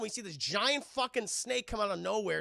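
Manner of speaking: ordinary delivery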